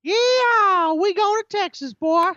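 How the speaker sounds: high, hammed-up Texan drawl; Texas cowboy voice. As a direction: play the proud cowboy on request. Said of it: "Do it again".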